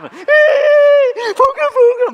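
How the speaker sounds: very high, very loud, slow shout; fervent crowd shout. Retold or imitated. Preacher mimicking a fervent crowd, very high and loud. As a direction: scream it in rapture.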